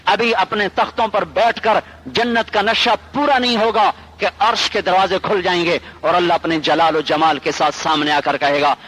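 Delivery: ordinary delivery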